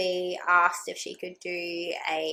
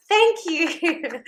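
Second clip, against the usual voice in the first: a high, louder, overjoyed "thank you"; relieved thanks; 9 semitones higher, 5 dB louder than usual. Retold or imitated. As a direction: gush like you've been saved. Greeting the anaesthetist, huge relief.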